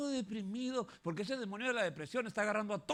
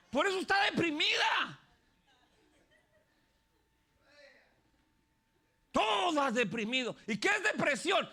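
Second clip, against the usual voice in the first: much higher and louder preaching cry; preaching shout; 7 semitones higher, 5 dB louder than usual. Real, not acted. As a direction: hammer it home from the pulpit. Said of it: "preaching at full force". (predicador gritando con fuerza)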